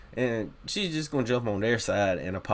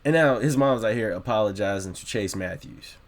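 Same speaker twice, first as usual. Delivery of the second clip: ordinary talk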